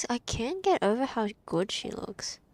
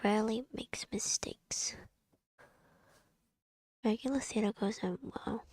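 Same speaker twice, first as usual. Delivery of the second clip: whispered reading. Real, not acted. Whispers game text to self.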